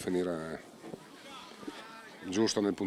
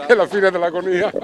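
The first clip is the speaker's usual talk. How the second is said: playful; 8 semitones higher, 12 dB louder than usual. Real, not acted. Joking punchline after laugh.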